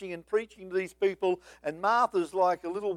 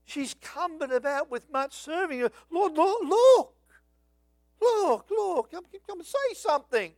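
high, whiny mock Martha, pleading louder in places; bleating complaint; 8 semitones higher, 4 dB louder than usual. Martha complaining to Jesus about her sister, in a high whiny bleat. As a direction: whine at the Lord like a put-upon hostess. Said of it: "when she bleated out".

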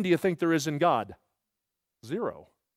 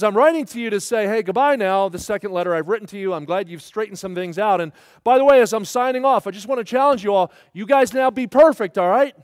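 lightly acted cheery sign-off, raised and loud; a hint of a breezy letter writer, louder; 4 semitones higher, 7 dB louder than usual. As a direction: toss out a big challenge as a casual goodbye. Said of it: "And he says".

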